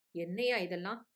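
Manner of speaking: ordinary delivery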